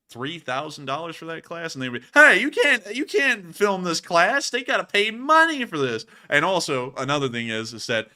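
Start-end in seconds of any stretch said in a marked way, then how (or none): 2.1-6.0 mock college official. College voiced objecting.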